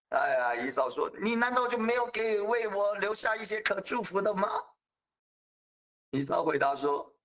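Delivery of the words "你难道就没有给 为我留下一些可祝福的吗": higher, indignant complaint; aggrieved Esau. Acted. Esau complains to his father that Jacob cheated him, indignant. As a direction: protest being cheated twice.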